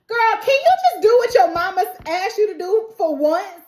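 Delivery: high, loud fed-up scold at Julie; exasperated scolding. Real, not acted. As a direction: scold her like a tired auntie.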